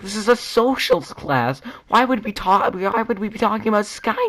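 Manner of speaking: exaggerated, worked-up classroom telling-off; mock exasperated scolding. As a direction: scold in hammed-up disbelief. Acts out exasperated reply in class.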